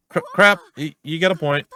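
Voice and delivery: high, loud stammered concession; rowdy detective. Acted. Rowdy detective realising the techie detective is right, flustered and loud. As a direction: blurt it out high, tripping on the first sounds.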